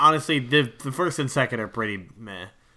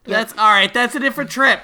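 much higher and louder laughing protest; excited objection; 9 semitones higher, 7 dB louder than usual. Real, not acted. Friend objecting to dark joke, much higher, louder laughing protest. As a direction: cry foul, high and loud.

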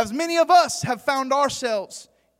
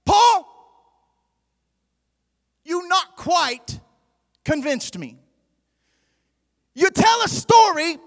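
King Agrippa; 5 semitones higher, 4 dB louder than usual. Acted. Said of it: "what he was really saying was".

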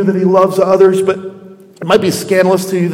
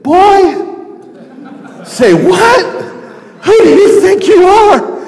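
indignant father; 9 semitones higher, slower than usual. Pretend. Father rebuking his demanding son, high and indignant. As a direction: play the insulted dad, pitched way up. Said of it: "He could have said".

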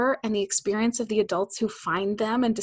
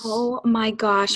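amazed; much slower than usual. Real, not acted. Amazed at the idea.

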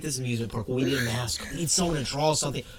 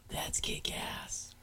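breathless whispered awe; gasp, then whisper it in awe; awed whisper; 17 dB quieter, much slower than usual. Real, not acted. Co-host stunned by the reactor park, whispering in awe.

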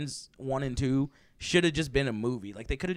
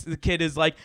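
ordinary delivery

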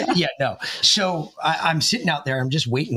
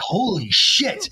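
stretched out shock; 5 semitones higher, much slower than usual. Retold or imitated. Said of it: "I was like".

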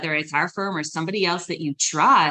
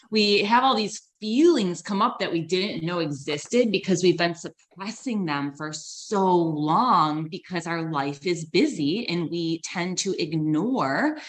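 ordinary talk

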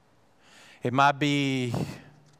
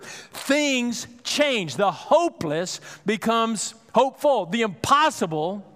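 higher, louder, with slow, drawn-out, weighty phrases; preaching at full force; 6 semitones higher, 5 dB louder than usual. Acted. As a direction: preach it to the back row, climbing in fervour.

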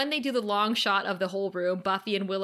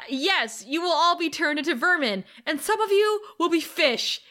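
higher, hammed-up proclamation; emphatic comic threat, pitched up; Buffy, mock threat; 6 semitones higher, 4 dB louder than usual. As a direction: warn the crowd with playful menace. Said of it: "Buffy's like".

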